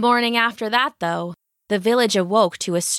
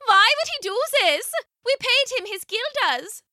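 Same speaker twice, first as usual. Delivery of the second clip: high-pitched, dismayed villager woman; distraught woman crying out; 13 semitones higher than usual. A narrator's reading. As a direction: protest in a much higher voice, upset. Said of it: "cried a woman standing by".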